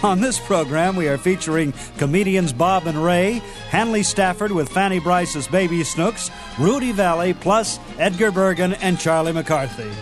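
normal talking voice

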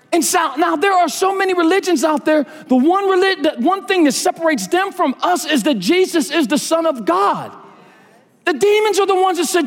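very high, ringing sermon pitch; impassioned preaching. Real, not acted. Preacher challenging those who only believe in God, impassioned preaching. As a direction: press the challenge on the congregation with full fervour.

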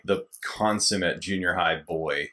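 usual speaking voice

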